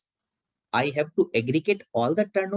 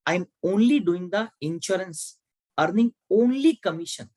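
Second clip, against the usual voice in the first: "only" punched higher each time it comes; stress on only; 4 semitones higher than usual. Real, not acted. Teacher explaining an exception to insurance agents, stressing "only". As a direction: lean hard on the word "only", pitched up. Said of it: "I am stressing this word only".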